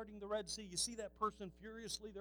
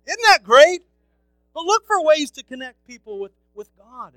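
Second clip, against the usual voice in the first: preaching at full force; 7 semitones higher, 26 dB louder than usual. Acted. Pastor urging his congregation, full-force preaching. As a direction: call the church to bless people.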